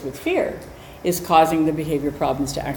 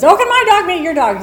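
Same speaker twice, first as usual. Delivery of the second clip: high, bright, louder pleading; imitating eager woman; 12 semitones higher, 6 dB louder than usual. Storyteller mimicking the pushy woman on the trail, much higher. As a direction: chirp it like an overly eager dog walker. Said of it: "and she says".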